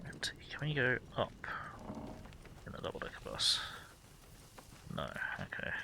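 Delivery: whispering. Real, not acted. Sneaking near enemies.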